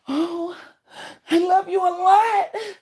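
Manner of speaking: high, loud, slow, over-sweet love declaration; mocking gushy confession. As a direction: ham up a syrupy I love you to mock them. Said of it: "Is that how you wanted me to say it?".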